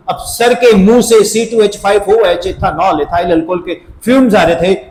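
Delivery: usual speaking voice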